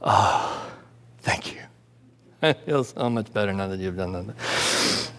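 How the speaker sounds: low, soft, relieved parent voice; a gently mocking, relieved parent. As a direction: sigh out the thanks like a grateful parent. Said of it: "They go".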